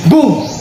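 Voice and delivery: sudden high-pitched "boom"; short, dramatic mimicked bomb blast; imitated explosion. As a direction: punch out the blast in one word.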